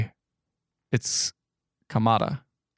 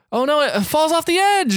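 mock alarm; 14 semitones higher than usual. Mock alarm narrating crash.

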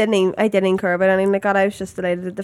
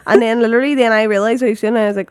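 normal talking voice

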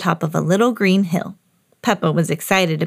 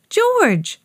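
much higher little pig calling her brother; high-pitched bossy sister; Peppa copying Mummy. A narrator's reading. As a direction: call out high, imitating a parent. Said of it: "just like Mummy Pig".

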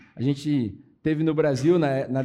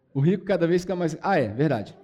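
ordinary delivery

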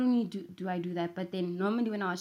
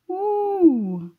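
high, loud playful "woo"; long high whoop into the echo; 11 semitones higher, 9 dB louder than usual. Real, not acted. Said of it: "I sound like a kid".

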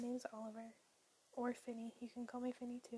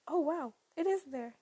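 loud, thrilled surprise; excited; 4 semitones higher, 11 dB louder than usual. Real, not acted. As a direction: blurt it out, amazed. Sees podcast live on Spotify.

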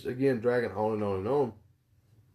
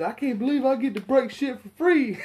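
very high, loud mimicked glee; mock-thrilled squeal breaking into laughter; way too excited; 14 semitones higher, 6 dB louder than usual. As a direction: imitate an overexcited kid, laughing through it. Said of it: "way too excited for this shit".

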